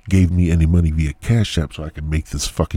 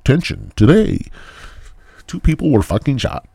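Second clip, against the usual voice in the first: hammed-up anchor read, higher and louder; mock news anchor; 8 semitones higher, 5 dB louder than usual. Host parodies a news anchor reporting a shooting. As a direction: play a dramatic TV newscaster. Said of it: "like broadcast news".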